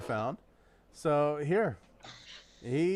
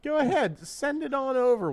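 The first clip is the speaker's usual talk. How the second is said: sing-song, pitched up; high playful voice; 10 semitones higher than usual. Acted. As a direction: tease him in a high voice.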